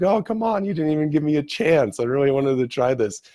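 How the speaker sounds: high-pitched imitation of a whining complaint; mock a disappointed man pleading for his chance; unhappy protest. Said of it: "not too happy about it".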